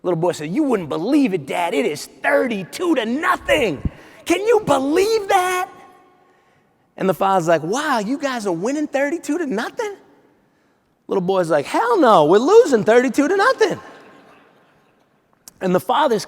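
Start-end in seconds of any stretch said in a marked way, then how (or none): 0.5-5.7 put-on a boy bursting with the news; little boy thrilled about the score; amazed kid. 7.6-10.0 higher and softer, rising in disbelief; a dad who can't believe it; father impressed by the score. Retold or imitated. 11.6-13.8 put-on much higher kid's voice with a laugh; boy laughing off a rout. Boy corrects his dad.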